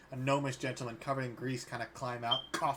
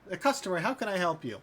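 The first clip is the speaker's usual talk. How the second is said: much higher, louder delighted greeting; eager shopkeeper; 6 semitones higher, 6 dB louder than usual. Acted. Halfling shopkeeper greeting a new customer, much higher and louder. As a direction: brighten up at a customer. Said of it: "he goes".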